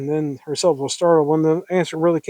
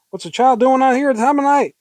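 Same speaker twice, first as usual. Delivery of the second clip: high, loud, indignant question; pitched-up, urgent disbelief; alarmed challenge; 9 semitones higher, 5 dB louder than usual. Acted. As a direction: demand it hysterically. Heroine challenges man with child.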